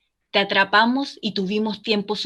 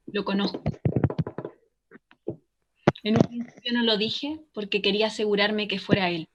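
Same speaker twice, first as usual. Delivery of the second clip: hushed confession; 7 dB quieter than usual. Acted. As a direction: speak softly with long pauses, holding it back. Caller nervously reveals she knows him. (confesión en voz baja)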